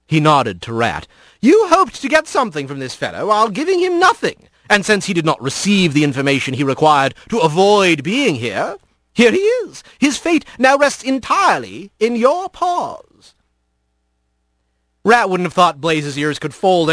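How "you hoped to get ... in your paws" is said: high, lilting rebuke; lordly scolding creature; reproving fox lord. Acted. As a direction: lay the blame in an arch high fox voice. Kit Fox scolds Blaze in story.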